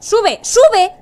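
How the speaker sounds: high, loud barked command; urgent shout. Real, not acted. Player shouting at the character to climb up. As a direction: yell it at the screen.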